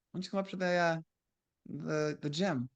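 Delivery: imitating a buddy's soft, hesitant pitch; mock-casual coaxing, softly spoken; scheming, casual invite. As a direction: float the invitation quietly, stalling on the last words. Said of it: "when he's talking like that".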